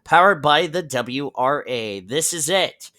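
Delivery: big booming broadcast opener; announcer voice. Acted. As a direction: welcome the crowd like a race announcer. Broadcast opening welcome.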